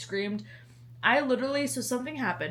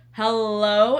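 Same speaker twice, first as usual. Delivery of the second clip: louder rising "Hello?" called across the house; 9 dB louder than usual. Retold or imitated. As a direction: call "Hello?" out loud to get attention. Calling to partner, retold louder.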